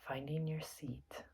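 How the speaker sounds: soft, unhurried meditation guide with long pauses; guided meditation. Acted. Teacher settling listeners into their seats for meditation, soft and calm. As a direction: gently talk them into stillness, leaving space between phrases.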